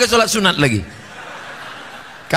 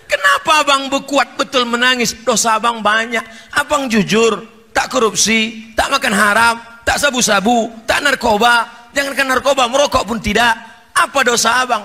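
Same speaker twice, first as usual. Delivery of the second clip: higher-pitched, insistent wife voice; wife questioning husband; 5 semitones higher than usual. Acted. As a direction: pile up questions as the puzzled wife. Wife questions her praying husband. (suara istri mencecar, bernada tinggi)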